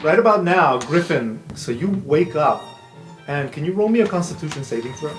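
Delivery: normal talking voice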